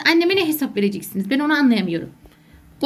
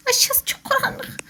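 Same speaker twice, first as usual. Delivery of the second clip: laughing fear; 8 semitones higher, 4 dB quieter, slower than usual. Real, not acted. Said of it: "Çok korkuyorum".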